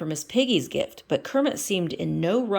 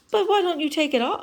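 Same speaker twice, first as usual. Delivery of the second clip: Kermit voice; 11 semitones higher, 4 dB louder, faster than usual. Acted.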